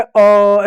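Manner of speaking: "अ" held long; drawn-out vowel. Shown as a demonstration. Teacher showing the vowel inside the letter, "अ" drawn out. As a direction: pull "अ" out steadily. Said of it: "ऐसे खींचा चला".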